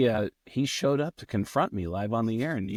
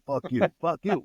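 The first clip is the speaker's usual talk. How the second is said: mock argument; 6 semitones higher than usual. Retold on-air shouting match.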